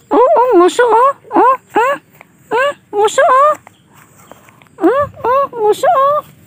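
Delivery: high cooing call for a pout. Real, not acted. Grandmother coaxing the baby to pout, high cooing.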